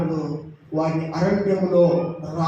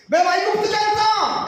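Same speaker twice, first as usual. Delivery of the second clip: high, loud mock shout; rebellious Israelites shouting; 4 dB louder than usual. Preacher voicing Israelites shouting they'll return to Egypt. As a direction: shout it up high as the grumbling crowd. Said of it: "Israel ప్రజలు ఏమన్నారు".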